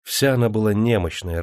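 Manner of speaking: ordinary delivery, nothing marked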